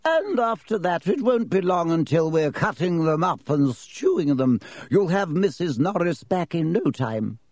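gentle, high matron voice; cheerful kindly nurse. Acted. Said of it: "telling Filch kindly".

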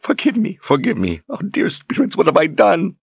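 high, anguished, broken pleading; sobbing remorse. A narrator's reading. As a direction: cry out for forgiveness.

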